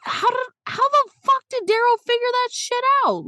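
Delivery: high-pitched mock outrage; squeaky disbelief. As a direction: squeak it out like you can't believe it. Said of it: "So I'm like".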